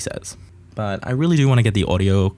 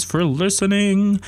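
high, drawn-out sign-off; slow, high sing-song podcast thanks; 10 semitones higher, slower than usual. Real, not acted. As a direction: stretch "listening" out on a high note.

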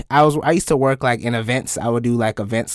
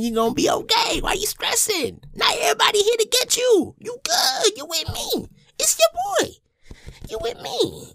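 high, soft cooing comfort; mock soothing reassurance; 4 dB quieter than usual. Feeling good, telling all it's okay, cooing reassurance. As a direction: coo it like soothing someone small. Acted soothing pep talk after compliment.

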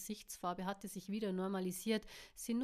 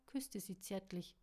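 plain reading voice

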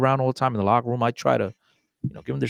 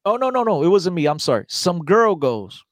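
ordinary talk, nothing marked

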